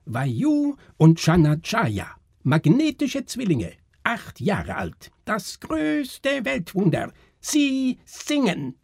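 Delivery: very high, drawn-out barker's cry; piercing woman's voice. A narrator's reading. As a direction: call out the attraction slowly, high and piercing. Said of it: "rief eine gellende Frauenstimme". (gellende Frauenstimme, hoch und langgezogen ausrufend)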